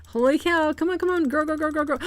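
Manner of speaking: high, hopeful cheering-on; will it to grow, rapid repeats; excited urging. Real, not acted. Urging a rare egg to grow.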